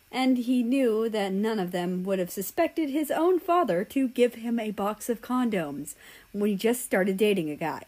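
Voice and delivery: usual speaking voice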